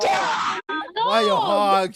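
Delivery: loud, high, slow mock shout; mock surprise. Playing along when kids shout dog, mock shocked. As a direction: cry it out in fake shock.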